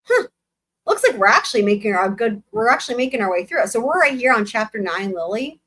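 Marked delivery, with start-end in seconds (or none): none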